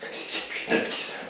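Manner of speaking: near-whispered count under strain; doing push ups. Real, not acted. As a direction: gasp out the count mid push-up. Coach counting during push-ups.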